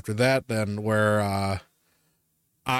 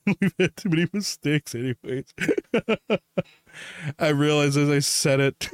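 higher voice breaking up with laughter; laughing; 5 semitones higher than usual. Real, not acted. Storyteller realising a slip, real laughing speech. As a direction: laugh helplessly through the words. Laughing at spoiling own name.

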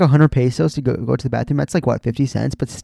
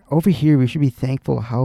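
usual speaking voice

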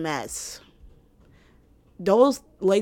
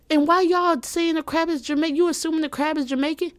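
higher, faster mock outrage at the audience; mock indignant; 8 semitones higher than usual. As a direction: act shocked they assume it.

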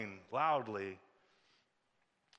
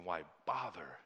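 doubting inner voice; 6 semitones lower, 5 dB quieter than usual. Pretend. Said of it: "You'll hear a voice saying".

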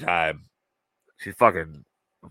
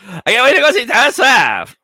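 much higher and louder, gushing mock fangirl; mocking a trying-to-be-relevant woman; 11 dB louder than usual. Co-host mocking a panelist's [name] line, high gushing mock fangirl. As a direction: squeal it like an aging woman faking teen excitement. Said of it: "a terrible attempt of, um, a middle-aged, aging woman trying to be relevant".

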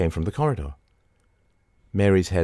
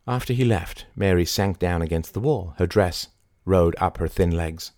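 plain reading voice

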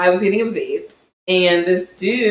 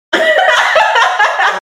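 loud laugh; 4 dB louder than usual. Real, not acted. Laughing at the cashier story.

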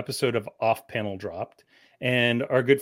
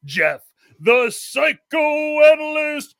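ringmaster; 8 semitones higher than usual. Pretend. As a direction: roll out the fighter's name like a boxing announcer. Boxing ring introduction of fighter.

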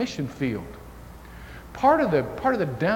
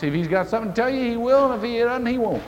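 put-on blunt farm boy talk; 7 semitones higher, faster than usual. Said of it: "And that's the way he talks".

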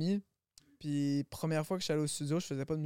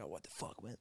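whispered disbelief; 9 semitones lower, 15 dB quieter than usual. Retold or imitated. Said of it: "je capotais".

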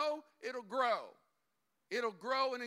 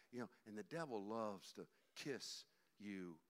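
usual speaking voice

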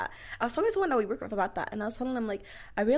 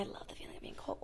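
whispering; 17 dB quieter than usual. Real, not acted. Disagreeing in low aside.

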